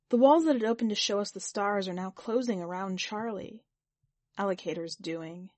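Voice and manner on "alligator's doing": ordinary delivery, nothing marked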